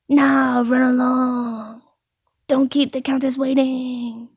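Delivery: lightly voiced baker, drawn out; a slow, put-on send-off; baker character. Baker shoos customer off to the Countess, lightly put-on.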